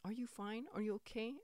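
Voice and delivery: high, soft fussing; mock concerned manager. Manager checking on employee who called off, mock concern. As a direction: ask high and softly with put-on concern. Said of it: "And she's like".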